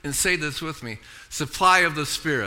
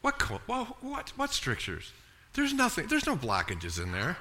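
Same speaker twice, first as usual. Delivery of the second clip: a hint of a puzzled doctor; lightly voiced doctor, soft and puzzled; imitating doctor; 8 dB quieter than usual. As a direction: mimic the doctor's surprise lightly. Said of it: "that doctor goes".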